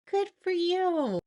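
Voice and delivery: high, loud self-cheer; proud cheer. Retold or imitated. Telling herself well done, a loud proud cheer. Self-praise shout after squaring shoulders.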